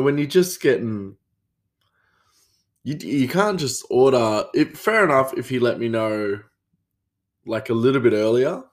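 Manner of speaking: normal talking voice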